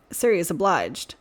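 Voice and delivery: ordinary delivery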